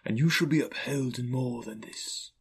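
hushed, lightly ghostly assurance; reassuring ghost. Acted. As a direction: promise support as an otherworldly spirit. Ghost reassures frightened Scrooge.

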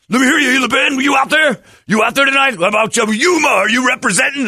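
high, loud arena-rocker crowd call; hammed-up rock star working the crowd; concert frontman hype. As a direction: pump up an empty crowd like a stadium show. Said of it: "He is having, he's doing a show in his head".

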